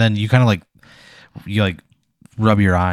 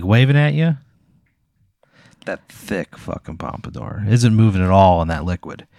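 normal talking voice

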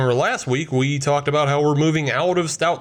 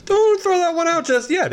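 high silly voice. Acted. Joking about old textbook.

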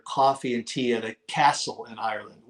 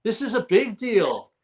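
laughing; 8 semitones higher than usual. Real, not acted. Laughing about a big deal.

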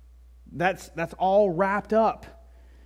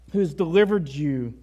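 ordinary talk, nothing marked

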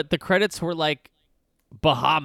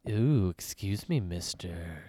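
low, quiet creepy villain voice; softly menacing villain taunt; 6 semitones lower, 9 dB quieter, slower than usual. Retold or imitated. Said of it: "kind of a cool villain voice".

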